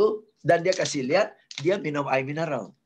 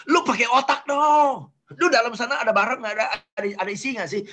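much higher, insulting, mocking voice of a tactless person; rude blunt talker; 7 semitones higher, faster than usual. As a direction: sneer the put-down at a coworker. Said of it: "ada orang buka mulut aja sakit hati". (meniru orang kasar yang menghina, suara jauh lebih tinggi)

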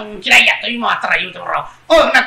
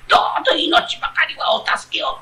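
breathy, pleading fox, higher; pitiful fox voice; 6 semitones higher than usual. Retold or imitated. Retelling the fox's plea to his friend, mock pitiful. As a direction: repeat the fox's plea mockingly, begging. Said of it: "哀れな声で".

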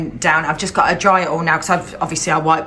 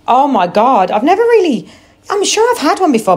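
squealing, much higher voice, quick at the end; high excited squeal of delight; excited gush; 8 semitones higher, 5 dB louder than usual. Real, not acted. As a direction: gush over it like a new favourite toy. Gushing over new sponge.

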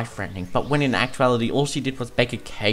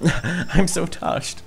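Twink, touched; 6 semitones higher, much slower than usual. Acted. Twink moved by Muscular.

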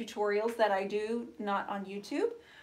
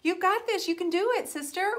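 high-pitched, peppy encouragement; cheerleading; 9 semitones higher than usual. Real, not acted. Host encouraging a viewer, high excited cheer. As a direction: cheer her on in a much higher voice.

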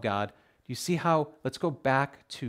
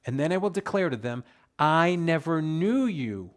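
louder, ringing pulpit reading; preached scripture reading; 5 semitones higher, 7 dB louder than usual. Shown as a demonstration. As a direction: proclaim the verse to the congregation. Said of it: "I'm gonna read it slowly".

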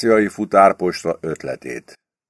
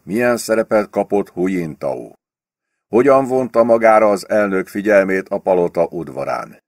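ordinary delivery

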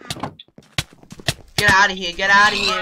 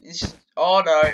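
usual speaking voice

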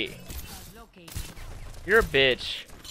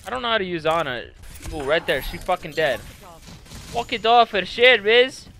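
ordinary talk

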